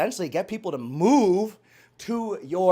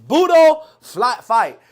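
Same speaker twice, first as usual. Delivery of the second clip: loud, drawn-out battle cry; emphatic shout; 5 semitones higher, 11 dB louder, slower than usual. Shown as a demonstration. Recalling how he said it, an emphatic shout. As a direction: belt it out slowly and high.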